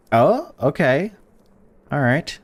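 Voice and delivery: ordinary delivery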